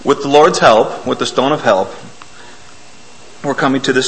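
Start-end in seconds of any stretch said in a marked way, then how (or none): none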